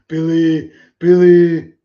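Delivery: loud Austrian yell of a name; Arnold-style shout. Retold or imitated. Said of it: "an Arnold impression".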